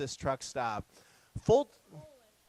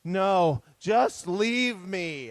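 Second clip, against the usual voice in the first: put-on dramatic teen; 2 semitones higher, 7 dB louder, much slower than usual. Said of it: "She's like".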